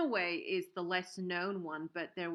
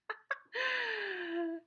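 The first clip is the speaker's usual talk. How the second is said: sudden bright giggle; high laugh; 14 semitones higher, 4 dB louder than usual. Real, not acted. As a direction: burst of high-pitched laughter. Laughing at viewer's joke.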